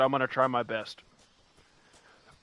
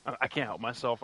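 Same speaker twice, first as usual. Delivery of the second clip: ordinary talk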